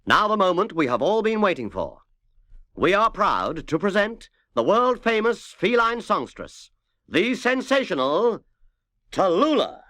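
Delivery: booming stage introduction; showbiz announcer. A narrator's reading. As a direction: build it up like a TV host presenting a star.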